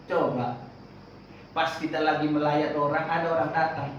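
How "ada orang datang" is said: usual speaking voice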